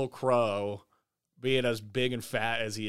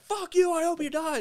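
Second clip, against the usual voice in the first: angry curse. Retold or imitated. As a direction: hurl it at the other driver. Retelling his road-rage curse.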